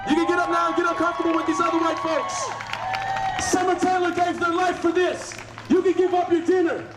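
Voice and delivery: high shouted protest speech; rousing union rally. Real, not acted. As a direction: shout it out to the whole street. Shouting to diners from march.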